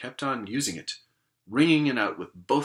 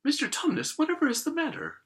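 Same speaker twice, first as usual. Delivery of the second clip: put-on much higher little girl voice; high worried girl; 3 dB louder than usual. Lucy asking the crying faun what is wrong, high and worried. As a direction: ask it as a small anxious child.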